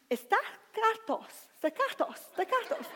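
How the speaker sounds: stakatos said in a high, drawn-out mock Greek accent; laughing at his own Greek accent on stakatos. Shown as a demonstration. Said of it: "I've got to say it with an accent".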